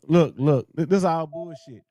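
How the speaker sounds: usual speaking voice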